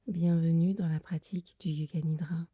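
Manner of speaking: guided meditation. Acted. Leads a Yoga Nidra meditation. (voix douce et lente de méditation guidée)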